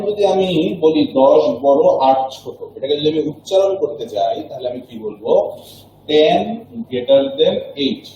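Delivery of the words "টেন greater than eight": very slow, word by word, teacher's model reading; slow demo reading. Shown as a demonstration. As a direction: slowly spell out greater than eight. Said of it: "বাম পাশ থেকে আস্তে আস্তে সামনের দিকে যাব".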